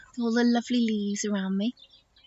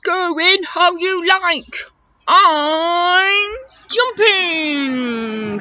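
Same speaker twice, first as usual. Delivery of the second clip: higher, louder, stretching into a whoop; gleeful elephant; 7 semitones higher, 5 dB louder, much slower than usual. Acted. Elephant leaping into river before friends, excited drawn-out call. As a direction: announce it big, then draw out jumping.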